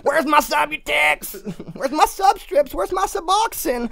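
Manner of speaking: high, slow whiny wail for their pills; mock junkie screaming. Mocking addicts begging for their pills, high shrill wail. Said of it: "They're screaming".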